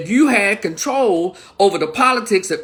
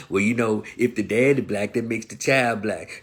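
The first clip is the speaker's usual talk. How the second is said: mocking voice; 10 semitones lower, 5 dB quieter than usual. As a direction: parrot their argument in a put-on voice.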